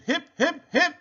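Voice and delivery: very high, happy hooted cheer; hooting. A narrator's reading. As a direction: hoot a triumphant hurray, way up high. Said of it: "They hooted".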